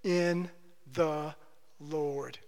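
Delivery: "in the Lord" spaced out, each word alone, falling low and soft; slow emphatic phrase. Shown as a demonstration. Pastor naming the doctrine, slow and low. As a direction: lay down "in", "the", "Lord" one by one, sinking. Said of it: "How is this to be fixed?".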